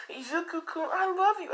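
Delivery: put-on very high, girlish pleading voice; acted clingy girlfriend. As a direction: plead your love in a squeaky high voice. Crying Rose pleads by phone.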